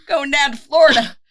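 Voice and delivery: high, loud, laughing Going down to Florida; sing-song retiree heading south; mock retiree. Joking Brooklyn people retire to Florida, high laughing mock voice. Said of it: "the way you say Florida".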